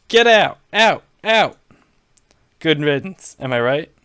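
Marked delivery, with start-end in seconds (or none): 0.1-1.6 loud, high shout; yelling. Acted. Donald yelling Jeb out of the store, loud shout. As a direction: bark him out of the room. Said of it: "Donald yelled at him".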